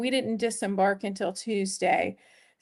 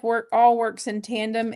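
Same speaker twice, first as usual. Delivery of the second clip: ordinary talk, nothing marked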